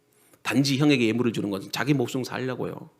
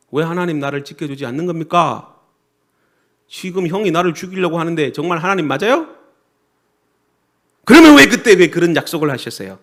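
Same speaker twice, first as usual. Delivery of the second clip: rising to a loud, accusing demand; acted-out resentful prayer, building in volume; angry complaint to God; 4 semitones higher, 11 dB louder than usual. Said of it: "화를 내면서 하나님께 원망하며 기도하는". (하나님께 화내며 원망하는 기도)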